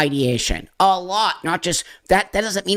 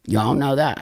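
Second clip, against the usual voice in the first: ordinary talk, nothing marked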